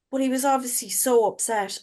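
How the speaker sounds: usual speaking voice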